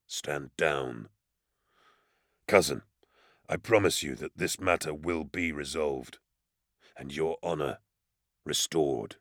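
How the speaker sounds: numb, empty and low; hollow, drained of feeling; dead voice. A narrator's reading. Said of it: "he said in a dead voice".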